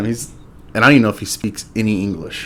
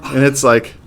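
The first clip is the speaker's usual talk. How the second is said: ordinary talk, nothing marked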